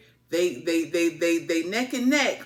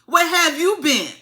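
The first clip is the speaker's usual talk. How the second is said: loud demand; 8 semitones higher, 9 dB louder than usual. Retold or imitated. Demanding twin's whereabouts.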